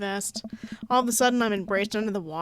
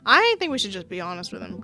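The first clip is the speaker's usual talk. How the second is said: ordinary talk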